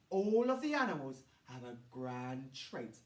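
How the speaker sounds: usual speaking voice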